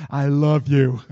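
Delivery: loud, blurted I love you; bold declaration. Retold or imitated. Husband blurts his love to new girlfriend, loud. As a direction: blurt it out loudly.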